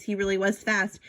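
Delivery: usual speaking voice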